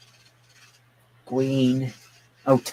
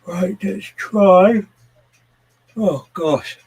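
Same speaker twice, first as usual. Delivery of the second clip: yawning; 9 semitones higher, 9 dB louder, much slower than usual. Real, not acted. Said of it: "I can't stop".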